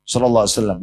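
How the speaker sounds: ordinary talk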